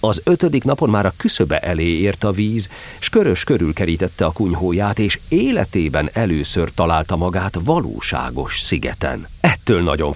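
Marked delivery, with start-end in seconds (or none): none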